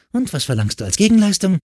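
put-on high, fast girl's retort; quick, high-pitched wary demand; suspicious challenge. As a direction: fire the question back distrustfully. Distrustful girl challenges the offer.

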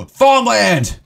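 high, loud exclamation after a gasp; excited discovery. Real, not acted. Gamer discovering farmland, excited high shout. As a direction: call it out like a great find.

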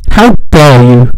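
loud, slow, outraged how dare you; loud, slow mock-outraged yell. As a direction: bellow it at the meme makers, slowly. Scolding meme makers, builds to yelling.

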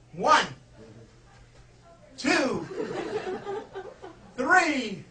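rising dramatic count; building countdown. Pretend. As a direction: count it up like a big reveal. Counting down the flea trick.

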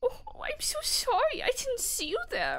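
super high-pitched, timid cutesy squeak; uwu voice. Shown as a demonstration. Player shows friend how to do it, uwu voice. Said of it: "the super high-pitched, like, uwu voice".

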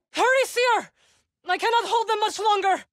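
strained, high call for help; high urgent cry while holding the line; urgent shout. Acted. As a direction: yell to her across the battle.

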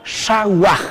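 "shawaj" with retroflex SH and a final J rasp; model pronunciation. Shown as a demonstration. Teacher introducing the word to learners, model pronunciation. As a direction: present "shawaj" on its own, ending in a Spanish J. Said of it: "les presentamos la palabra".

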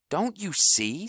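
high, pleading question; urgent. Acted. House urges the team to understand, high and urgent. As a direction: make them see it. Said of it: "said House".

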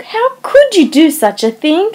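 wife appalled that a guest is coming, outraged; indignant dismay. A narrator's reading. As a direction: cry out in shocked reproach. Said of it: "a tone of mingled indignation, reproach, and dismay".

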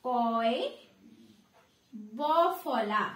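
ordinary talk, nothing marked

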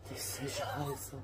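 breathy unvoiced whisper of disbelief; whispering at her son after the prank is revealed. Real, not acted. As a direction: hiss it at him, stunned. Mother realises it was a prank.

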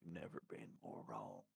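whispered aside. Real, not acted. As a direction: breathe it out unvoiced. Quiet aside.

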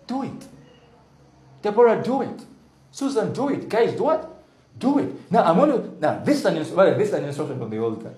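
high, urgent exhortation; rally them by name; exhorting. Acted. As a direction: pastor urging the church. Urging congregation to act.

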